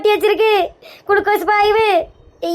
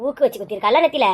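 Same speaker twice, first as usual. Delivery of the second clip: low, quiet, ominous disbelief; 9 semitones lower, 4 dB quieter than usual. Acted. Wife learns the wound was a pin prick, low and quiet. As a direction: let the menace simmer under the words.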